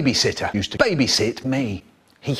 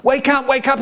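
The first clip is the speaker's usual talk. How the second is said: high, raised-voice urgent call; shouted wake-up; 10 semitones higher than usual. Acted. Dad waking his child on Monday, shouting. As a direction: shout it up high to rouse a sleeper. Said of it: "he shouts".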